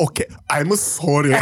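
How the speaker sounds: mocking, exaggerated fake apology; sarcastic apology. Mother apologizes to son after laughing in his face, sarcastic. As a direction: imitate a mother's asshole apology.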